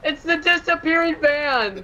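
amazed. Real, not acted. Amazed at vanished van.

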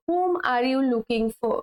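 drawn-out clear teacher's model; slow model sentence. Shown as a demonstration. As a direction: say it slowly, word by word, for learners. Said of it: "teacher models slow English". (ধীরে ধীরে শেখানোর ভঙ্গিতে বলা)

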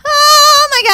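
high, loud amazed cry; thrilled disbelief. Real, not acted. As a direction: cry out in triumph. Made a hard jump.

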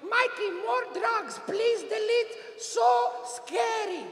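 very high, long drawn-out mother voice; imitated mother. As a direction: stretch Mom's scolding comment into a slow high plea. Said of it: "and she wrote".

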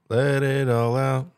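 slow, drawn-out announcer; mock shout direction. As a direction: stretch it out slowly. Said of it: "They both had the word shout in it".

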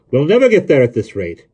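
loud, high-pitched frustration; exasperated complaint. A narrator's reading. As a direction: burst out, fed up with slogging. Exhausted Jonathan gives up.

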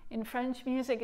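usual speaking voice

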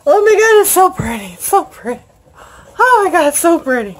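loud, high gushing over the book; thrilled gushing. Real, not acted. Booklover opening her package, gushing loudly and high. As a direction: swoon over how pretty it is.

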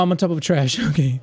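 ordinary talk, nothing marked

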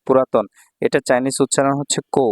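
ordinary talk

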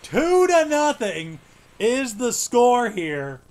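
slow drawn-out broadcaster score call; announcer call. Acted. Gamer calling the score like a broadcaster, slow and drawn out.

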